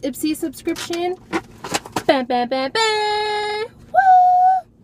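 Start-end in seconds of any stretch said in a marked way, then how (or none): 2.1-4.7 playful drumroll into high whoop; rising to a shrieking woo; excited cheer. Real, not acted. Unboxing with sound effects.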